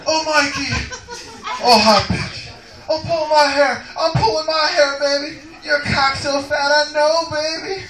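high, loud breathy mock moans; hammed-up high lover's moans during sex; moaning lover. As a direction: ham up a lover in ecstasy. Sex scene, woman moaning.

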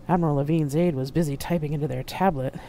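ordinary delivery

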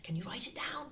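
whispered prompt; soft conspiratorial whisper. Shown as a demonstration. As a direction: quietly urge them to write. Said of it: "whispered prompt".

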